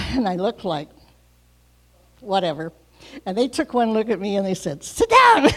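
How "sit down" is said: high, loud command to sit; firm order. Retold or imitated. Shop staff ordering the injured woman to sit down. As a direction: bark it as a sharp order.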